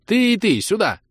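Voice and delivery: high, eager order to the chairs; excited command. Acted. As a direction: bark the order with glee. Саид commands two chairs.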